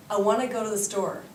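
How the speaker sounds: fast, casual Canadian linking on "I want to go to the store"; Canadian accent. Shown as a demonstration. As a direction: say it quickly the Canadian way, words running together. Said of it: "try it with my lovely Canadian accent".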